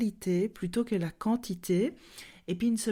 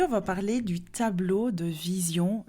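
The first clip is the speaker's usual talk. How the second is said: ordinary talk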